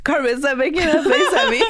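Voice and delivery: laughing, high and loud. Real, not acted. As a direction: laugh through the words. Laughing at co-host's face.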